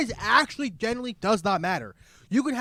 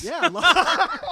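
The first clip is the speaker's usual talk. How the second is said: laughing; 12 semitones higher, 9 dB louder than usual. Real, not acted.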